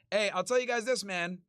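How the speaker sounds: usual speaking voice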